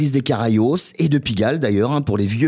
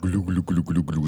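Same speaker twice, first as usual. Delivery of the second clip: deep, soft glugging like water bubbling; bath gurgles; 10 semitones lower, 6 dB quieter than usual. Pretend. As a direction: gurgle it low and quietly like a penguin in the tub. Mimics the penguin's bath gurgles. (l'animateur imite les glouglous du pingouin, grave et doux)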